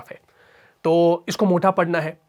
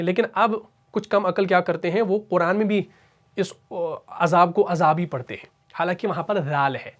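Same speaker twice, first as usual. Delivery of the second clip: usual speaking voice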